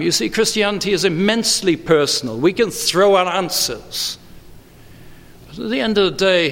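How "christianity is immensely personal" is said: ordinary talk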